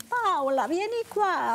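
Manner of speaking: high sing-out call across the beach; Italian parent calling. Retold or imitated. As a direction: call the child over in lilting Italian. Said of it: "als Kind am Strand war, in Italien". (hoher italienischer Ruf nach dem Kind)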